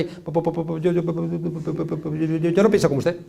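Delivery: mock a flustered official fumbling for words; imitating a cornered mayor stammering. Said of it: "Y se puso ahí".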